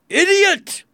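very high, loud outburst; furious high yell; angry shout. A narrator's reading. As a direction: shout the insult in royal fury. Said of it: "he shouted".

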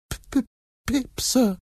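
put-on very high, stuttering frightened boy; trembling stammer. A narrator's reading. Boy gives his name to the frightening man, trembling. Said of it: "answered Pip, trembling".

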